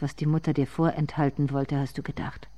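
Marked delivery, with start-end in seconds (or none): none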